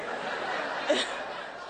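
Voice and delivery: breathy burst of laughter; real laugh. Real, not acted. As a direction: laugh out loud at the memory. Laughing at her own punchline.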